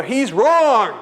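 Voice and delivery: high, loud mock pronouncement; loud punchline verdict. Spiritual leader's letter rebuts him, loud and gleeful. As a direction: proclaim the verdict with relish.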